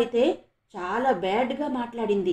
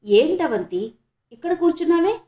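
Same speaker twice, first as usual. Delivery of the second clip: higher, louder surprised schoolgirl question; louder, high-pitched surprised call to a friend; 6 semitones higher, 6 dB louder than usual. Acted. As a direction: catch her sitting there and sound surprised. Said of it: "ఆశ్చర్యంగా చూస్తూ అడిగింది".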